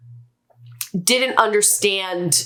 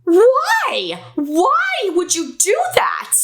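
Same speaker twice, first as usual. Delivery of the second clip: much higher, louder outraged why; angry mother; 10 semitones higher, 3 dB louder, slower than usual. Retold or imitated. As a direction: let the mom burst out in disbelief. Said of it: "she was like".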